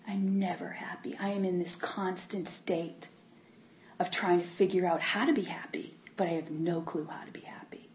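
soft sad whisper. Real, not acted. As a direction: breathe it out in a whisper. Confessing unhappiness.

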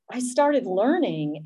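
usual speaking voice

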